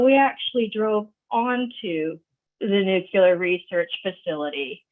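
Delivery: normal talking voice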